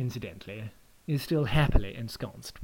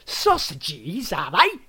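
rising, louder mocking sausages; loud taunt; 5 semitones higher, 7 dB louder, much slower than usual. Adam mocks the guest's word, loud and rising. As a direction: fling it back at him, mocking. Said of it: "taunted Adam".

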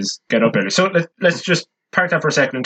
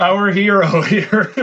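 slow, laughing mock praise; laughing tease; 5 semitones higher, much slower than usual. Real, not acted. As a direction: tease him through a laugh. Teasing co-host about big tip.

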